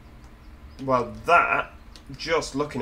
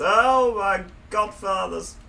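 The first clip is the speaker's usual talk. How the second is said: higher, louder, drawn-out cry of alarm; startled exclamation; 7 semitones higher, 5 dB louder, slower than usual. Real, not acted. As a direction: yelps as the bus goes over. Driving off the edge.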